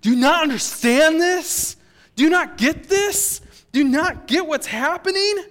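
high, loud, exasperated questioning; acted governor losing his patience; frustrated. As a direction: build to shouted disbelief at a silent prisoner. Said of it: "frustrated with him 'cause he's like".

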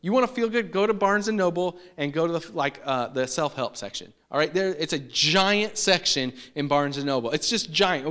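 ordinary talk, nothing marked

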